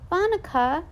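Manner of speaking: put-on little kid calling. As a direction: pipe up like a curious toddler.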